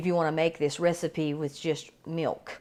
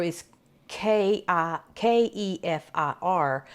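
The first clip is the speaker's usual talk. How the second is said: ordinary delivery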